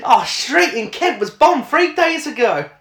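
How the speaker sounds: high mock quote, laughing; mocking film line. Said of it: "she actually says the words".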